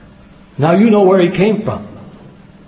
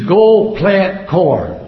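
each word slow and separate; mock-solemn, spaced-out correction; drawn-out punchline; much slower than usual. Punchline parodying the letters' reading.

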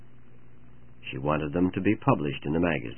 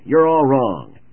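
loud, high-pitched objection; an editor loudly overruling a poet; crying out; 7 semitones higher, 10 dB louder, slower than usual. A narrator's reading. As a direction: burst out in emphatic contradiction. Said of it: "cried the editor".

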